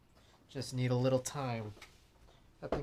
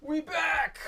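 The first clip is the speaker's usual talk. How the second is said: excited; 13 semitones higher than usual. Real, not acted. Excited return to stream.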